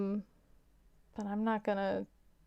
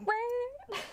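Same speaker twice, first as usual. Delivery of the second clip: high-pitched "brrring" engine noise; vocal cartoon motorbike pulling away; imitated motorcycle zoom. Rabbit speeding off after the punchline, imitated engine noise.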